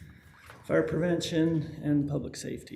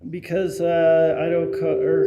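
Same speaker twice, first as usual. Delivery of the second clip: booming, much louder into the mic; real loud voice, too strong for the microphone; 9 dB louder than usual. Real, not acted. Fire chief briefing the council, accidentally booming loud. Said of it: "sorry, I have a loud voice".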